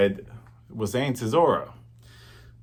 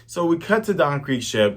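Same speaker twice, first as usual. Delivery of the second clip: normal talking voice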